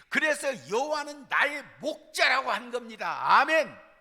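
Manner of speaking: loud, rising sermon build to amen; preaching at full force. Acted. (힘차게 고조되는 설교)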